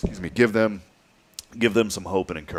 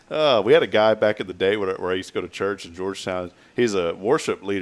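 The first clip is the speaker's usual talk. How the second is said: normal talking voice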